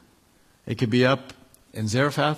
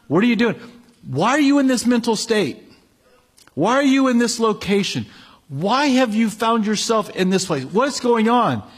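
much higher and louder, pressing questions; urgent preaching; 8 semitones higher, 3 dB louder than usual. Acted. God confronting the congregation, urgent preaching. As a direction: press each question on the listener.

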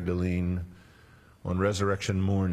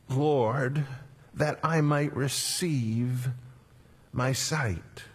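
higher, slow plea with a lift on "I"; a blind man's raised, slow plea to Jesus; pleading blind man; 5 semitones higher, much slower than usual. Acted. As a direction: beg for your sight, voice raised.

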